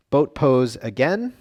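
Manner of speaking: normal talking voice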